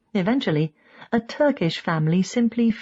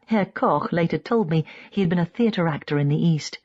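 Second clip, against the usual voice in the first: plain reading voice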